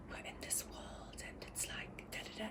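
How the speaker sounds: imitating her child self in an awed whisper; childlike whisper of wonder. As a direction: whisper it like a kid playing pretend. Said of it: "I'd be like".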